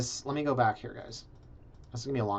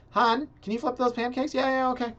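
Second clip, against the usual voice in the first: higher, put-on voices for a couple chatting; acted couple; 11 semitones higher than usual. Mocking a tiny condo, a couple's exchange in higher put-on voices. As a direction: play the spouse asking and the other agreeing.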